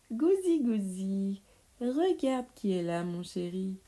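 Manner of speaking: witch's singsong wake-up call; mock-sweet witch coaxing. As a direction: coo teasingly as if waking a little darling. Witches mockingly coax the boy awake.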